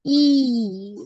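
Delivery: ई held long, falling gently; long drawn-out vowel. Shown as a demonstration. Teacher repeats long vowel for students, drawn-out ई. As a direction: keep ई sounding well past normal length. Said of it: "लंबा खींचते हैं".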